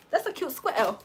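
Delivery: squirrel as "skwettle", imitating UK speech; mock UK squirrel. Teasing the way they say it, mock UK accent. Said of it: "I can't believe they say squirrel".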